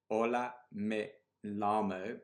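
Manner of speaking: slow, halting pupil reading; reading badly. Shown as a demonstration. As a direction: drag out each word like a struggling learner. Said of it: "if I'm gonna read it badly".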